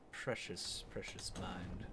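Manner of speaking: hushed, breathy mock-tender whisper. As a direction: whisper it like cradling something fragile. Mock self-pity, whispered.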